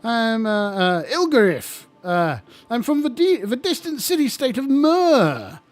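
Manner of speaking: high, jolly lich host voice; chirpy undead host, eager to please; friendly lich. Acted. Lich greeting the young adventurers, high and chummy.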